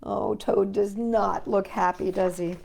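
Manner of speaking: plain reading voice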